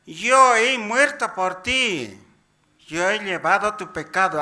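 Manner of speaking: Christ. Retold or imitated. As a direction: proclaim it high and forcefully, stretching the opening words. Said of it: "Cristo nos se presenta".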